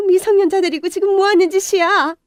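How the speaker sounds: 높은 목소리로 격하게 따지는 엄마; 화가 치밀어 몰아붙이듯; 분노한 호통. Acted. Mother catches son with girl. (아들 곁의 소녀를 꾸짖는 엄마, 분노한 고함)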